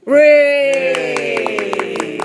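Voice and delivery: loud, high, drawn-out hooray; celebratory cheer. Real, not acted. Co-host cheering the podcast line-up, celebratory cheer. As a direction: let out a long, loud cheer.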